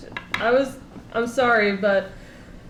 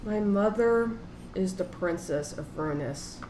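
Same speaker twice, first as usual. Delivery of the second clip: low, hushed secret-telling; whispered confession; 5 semitones lower, 9 dB quieter than usual. Acted. Player confides a secret to Gideon in private, hushed. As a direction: drop right down and confide it softly. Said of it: "he just whispers to him that basically".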